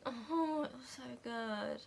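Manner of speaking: soft, choked gush of praise; tearful. Real, not acted. Gushing to viewers about the song, softly tearful. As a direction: overcome by the song. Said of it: "I was, like, sobbing".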